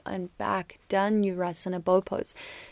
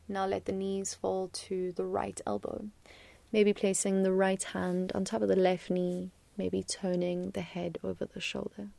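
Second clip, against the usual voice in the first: hushed, calm yoga-teacher voice. Acted. Soft cool-down cues in a twist.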